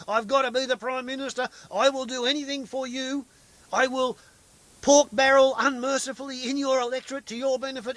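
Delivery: high, loud, desperate begging voice; whiny pleading Abbott. Retold or imitated. Abbott begging Tony Windsor to make him Prime Minister, in a high whining plea. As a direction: beg like a spoiled child who wants the top job. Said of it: "Tony Abbott went and said".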